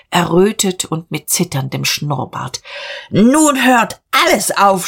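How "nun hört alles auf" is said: high, loud, drawn-out outrage; outraged shout. A narrator's reading. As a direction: cry out slowly in sheer indignation. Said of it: "schrie er".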